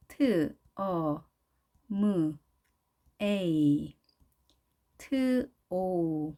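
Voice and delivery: 트, 어, 무, 에이, 트, 오 drawn out one at a time; slow isolated sounds 트, 어, 무, 에이, 트, 오 with pauses between. Shown as a demonstration. Teacher reading phonetic symbols aloud, each sound separately. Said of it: "발음 기호를 그대로 옮겨봤는데요".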